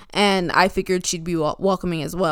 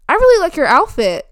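bright compliment; 8 semitones higher, 7 dB louder than usual. Retold or imitated. Stranger's compliment, acted brightly.

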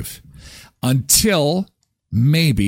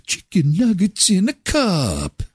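a higher, slow sing-song slogan; mock jingle; 6 semitones higher than usual. Shown as a demonstration. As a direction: announce it like a little jingle, slowly. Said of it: "repeats the slogan".

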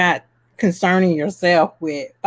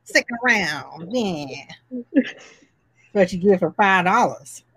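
gangster voice; 6 semitones higher than usual. Pretend. Keeps gangster voice for viewers.

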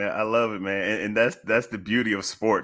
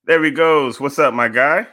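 excited greeting; 7 semitones higher, 8 dB louder than usual. Real, not acted. Host greets guest joining.

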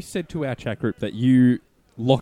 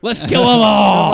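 high, loud, slow evil cheer; mock villain cheer; 10 semitones higher, 11 dB louder, slower than usual. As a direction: shout it like a cartoon villain. Mock bad-guy cheer.

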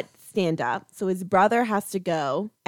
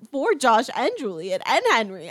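ordinary talk, nothing marked

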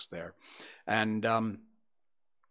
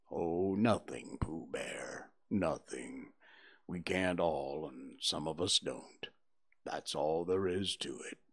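gloomy donkey; 8 semitones lower, 9 dB quieter than usual. A narrator's reading. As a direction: drag it out like a sad old donkey. Eeyore replies to Pooh.

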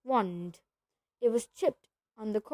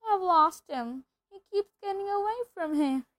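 wailing; 9 semitones higher, 3 dB louder than usual. A narrator's reading. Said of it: "he wailed".

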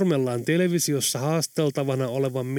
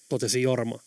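plain reading voice

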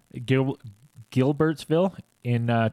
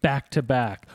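normal talking voice

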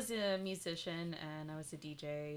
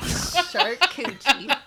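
laughing mock Okie; 7 semitones higher, 19 dB louder, much slower than usual. Real, not acted. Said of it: "Said that in my best Okie accent".

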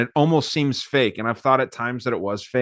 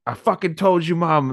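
mock yell; 6 semitones higher than usual. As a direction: throw it at mom like a triumphant champ. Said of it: "he's just yelling at his mom".

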